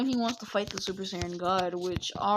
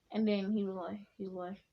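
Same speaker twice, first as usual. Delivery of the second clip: ordinary delivery, nothing marked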